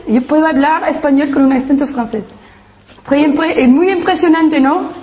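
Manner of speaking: fast Spanish with a French accent. Shown as a demonstration. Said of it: "I can now do a French accent in Spanish".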